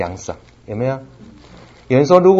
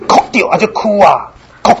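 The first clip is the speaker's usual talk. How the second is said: imitating a little boy's voice, higher and louder; small child voice; 9 semitones higher, 5 dB louder than usual. Father imitates his little son, childlike and higher. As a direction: sound like his small son after a bump.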